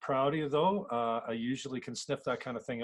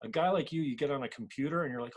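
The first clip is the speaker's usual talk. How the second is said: ordinary talk, nothing marked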